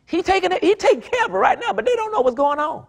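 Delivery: high, fast and fervent, climbing in pitch; excited preaching. Real, not acted. Preacher to church, Jesus already at work, excited high cry. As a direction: preach it like the miracle is happening right now.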